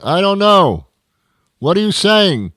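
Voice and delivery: furious driver shouting at a drive-through speaker he can't understand; angry shouting. Acted. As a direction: yell back at the garbled speaker.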